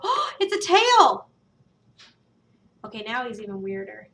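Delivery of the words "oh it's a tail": gasp, then a high, loud "tail!"; real delighted discovery; suddenly getting where the piece goes; surprised gasp. Real, not acted.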